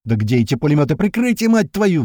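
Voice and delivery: angry shout climbing high; enraged roar. A narrator's reading. Sliva demands machine-gun cover, roaring angrily. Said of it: "взревел Слива".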